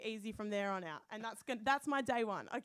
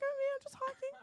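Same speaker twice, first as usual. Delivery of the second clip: higher, teary squeak insisting all is fine; whimpering insistence; 13 semitones higher, faster than usual. Retold or imitated. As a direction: pretend everything's normal while about to cry. Hiker insisting, near tears.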